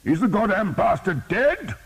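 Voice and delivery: high, loud bellow; roaring. A narrator's reading. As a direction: demand it over the din. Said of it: "the bishop roared".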